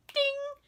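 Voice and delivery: a sudden high bell-like ding; comic ding; mock bell ding. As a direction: imitate a bell going off in her head. Old lady perks up.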